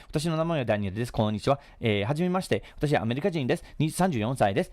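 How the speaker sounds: rapid-fire Japanese introduction; fast, run-together Japanese demo; speaking fast. Shown as a demonstration. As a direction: rattle it off in a quick stream. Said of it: "there are people that speak fast".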